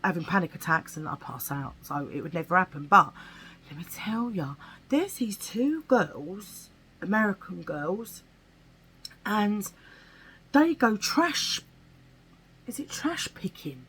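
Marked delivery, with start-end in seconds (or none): 3.7-4.5 whisper. Real, not acted. Starting a juicy story. 12.7-13.9 whisper. Real, not acted. Unsure of the word.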